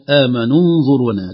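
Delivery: "آمنوا انظرونا" run together as "amanun-zuruna"; joined, hamza dropped. Shown as a demonstration. Sheikh models joined reading, "آمنوا انظرونا" with silent hamza. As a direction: link "آمنوا" straight into "انظرونا" without a glottal stop. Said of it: "ساقطة في الوصل".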